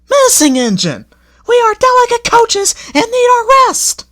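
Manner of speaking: very high, loud fussy coach voice; grumbling coaches. A narrator's reading. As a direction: complain like delicate old ladies. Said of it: "began to grumble".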